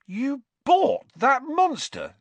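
high, loud, slow disbelief; aghast. A narrator's reading. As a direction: gasp it out open-mouthed, appalled. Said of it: "his mouth hanging open".

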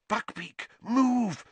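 hushed name, then a sharp high push; urgent hiss. Acted. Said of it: "Harry hissed".

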